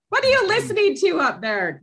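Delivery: high called-out parent voice, far off; parent calling upstairs. Retold or imitated. Parent calling up to the kid's room, high and suspicious. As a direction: yell up the stairs at the kid.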